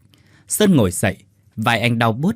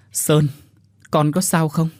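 tearful mother; 5 semitones higher than usual. Acted. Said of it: "Bà Liên khóc nức nở".

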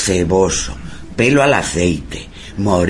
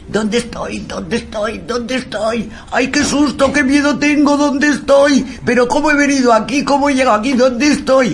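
very high, frantic frightened-boy impression; high-pitched panic, words tumbling out in repeated questions; mock panicked cry; 13 semitones higher than usual. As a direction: imitate a lost boy crying out in fright. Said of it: "gritando, bueno, con un acento british".

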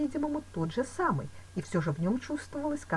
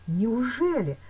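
mildly raised cry of surprise; startled exclamation; 7 semitones higher, 6 dB louder, much slower than usual. A narrator's reading. Man alarmed at what she did, exclaiming. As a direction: exclaim in disbelief. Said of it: "вскричал я".

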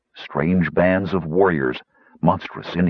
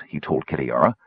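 ordinary talk, nothing marked

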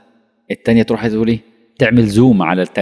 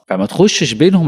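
normal talking voice

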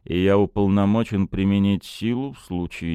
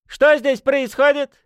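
high thin tenor, louder; squeaky indignant tenor; 5 dB louder than usual. A narrator's reading. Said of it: "тенорком проговорил он".

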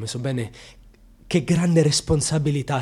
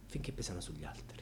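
whisper; 7 semitones lower, 16 dB quieter than usual. Acted. Bitter monologue about shame.

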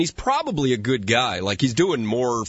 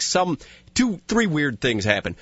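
ordinary talk

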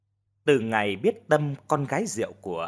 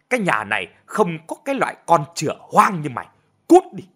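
loud, higher angry yelling; furious shout; 5 semitones higher, 5 dB louder than usual. A narrator's reading. Father screaming at his pregnant daughter, furious. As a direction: throw her out with rage. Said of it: "Ông vừa chửi".

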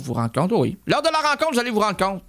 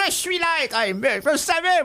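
acted delight; 6 semitones higher than usual. Acted reply in the phone conversation.

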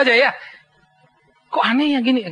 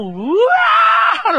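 high startled shout of wah; alarmed cry; 13 semitones higher, 5 dB louder than usual. Retold or imitated. Boy fleeing the dog, high startled shout.

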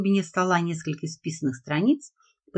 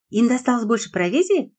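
bright, eager question, raised high; lively girl; 6 semitones higher, 5 dB louder than usual. A narrator's reading. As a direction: ask it eagerly like a curious girl. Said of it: "живо поинтересовалась Лиза".

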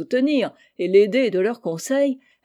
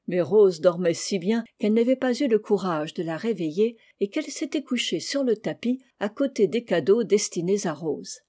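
usual speaking voice

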